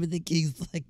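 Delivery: ordinary talk, nothing marked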